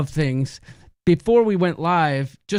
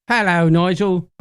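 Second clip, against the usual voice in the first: put-on British accent; 5 dB louder than usual. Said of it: "my British accent".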